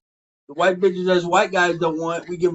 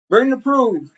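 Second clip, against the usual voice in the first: louder, higher, pushing hard; demanding proof; 6 semitones higher, 5 dB louder, slower than usual. Real, not acted. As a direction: demand it, raising the voice. Heated argument, demanding proof.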